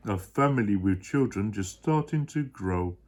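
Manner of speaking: low, loud gentle giant pleading; a big kind elephant voice, sure of himself; deep Horton voice, earnest. Acted. Dad as Horton explaining to his child.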